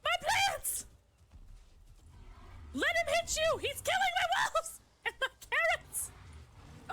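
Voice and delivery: high, anguished wailing; distraught cry. Real, not acted. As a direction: wail like your farm is ruined. Game animals wrecking her farm.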